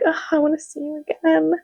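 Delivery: voicing Palmer. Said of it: "She's like".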